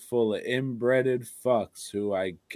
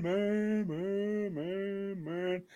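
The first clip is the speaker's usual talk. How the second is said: spooky announcer; 8 semitones higher, 9 dB quieter, slower than usual. Acted. Spooky horror title reading.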